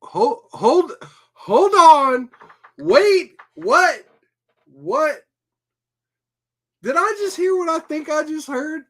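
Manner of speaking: can't believe what you just heard; shocked disbelief. Real, not acted. Shocked at the FBI friends.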